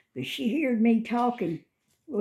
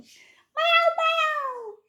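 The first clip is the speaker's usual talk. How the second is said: loud, high-pitched meow call; cat meow; 4 dB louder than usual. Real, not acted. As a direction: meow loudly up in falsetto. Meowing to the cat.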